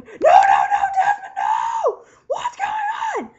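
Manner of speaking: loud, high, hammed-up screaming; screamed viewer reaction. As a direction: wail it out as an over-the-top fan freak-out. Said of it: "we all started going".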